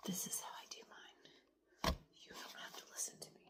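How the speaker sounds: late-night whisper so nobody wakes; hushed whisper, parents asleep nearby. Real, not acted. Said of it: "Sorry, I'm whispering".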